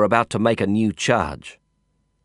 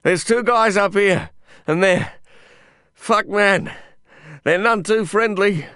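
panting shout; 11 semitones higher than usual. Acted. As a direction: yell it, still out of breath.

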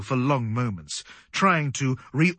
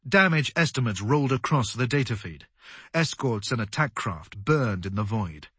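usual speaking voice

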